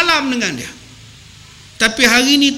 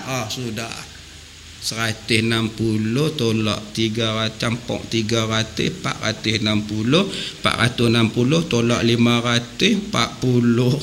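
muttered sums; 10 semitones lower, 6 dB quieter than usual. Real, not acted. Muttering sums after the fine.

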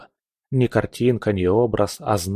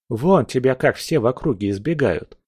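usual speaking voice

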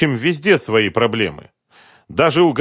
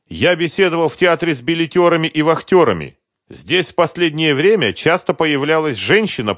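lightly voiced brisk report, higher; brisk colleague voice; 8 semitones higher, 5 dB louder than usual. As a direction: report it quickly, a bit higher. Another character's phone report.

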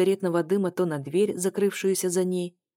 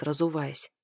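ordinary delivery, nothing marked

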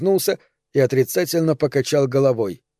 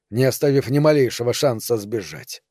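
plain reading voice

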